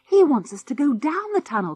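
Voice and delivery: excited cry. A narrator's reading. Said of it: "cried George".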